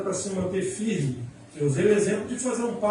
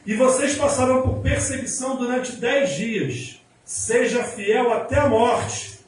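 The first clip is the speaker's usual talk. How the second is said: louder, emphatic preaching proclamation; forceful scripture reading; 4 dB louder than usual. Acted. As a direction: proclaim the verse to the whole congregation. Reads scripture at full force.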